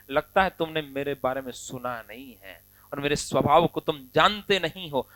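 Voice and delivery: ordinary delivery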